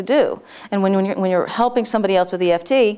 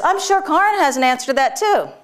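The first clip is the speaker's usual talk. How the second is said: higher, sing-out voice of a teacher calling on someone; mimicked teacher's higher-pitched announcement; imitating Linda; 7 semitones higher than usual. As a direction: brightly hand the question off, in imitation. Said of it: "then she goes".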